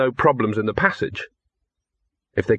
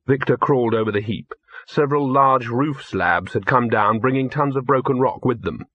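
usual speaking voice

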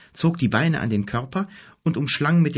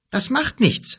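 higher, louder brave boast; a boy brushing off the danger, pitched up; determined boy; 13 semitones higher, 4 dB louder than usual. A narrator's reading. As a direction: toss it off boldly. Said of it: "Kim brave, first time".

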